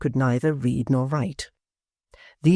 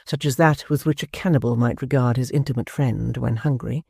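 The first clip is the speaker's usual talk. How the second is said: ordinary delivery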